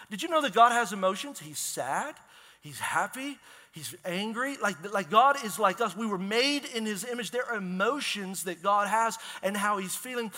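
ordinary delivery

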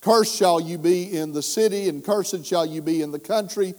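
loud fervent preaching voice; preaching at full force. Acted. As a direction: preach it out to the back pews.

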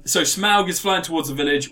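usual speaking voice

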